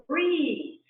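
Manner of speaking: yelling at herself. Acted. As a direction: bark it at yourself, impatient. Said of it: "I'm practically yelling at myself".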